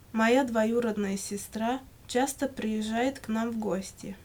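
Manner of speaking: usual speaking voice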